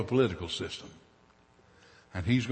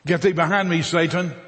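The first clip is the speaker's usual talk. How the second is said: loud, higher commanding rebuke; Jesus rebuking the tempter sharply; stern rebuke; 6 semitones higher, 6 dB louder than usual. Acted. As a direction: cast the tempter off.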